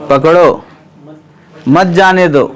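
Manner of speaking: falling पकड़ो, long break, then मत जाने दो together; pause after पकड़ो. Shown as a demonstration. Teacher repeats what the king wrote, pause after पकड़ो. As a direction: set पकड़ो apart as its own command before मत जाने दो. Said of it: "अरे, लिखा क्या उन्हें?".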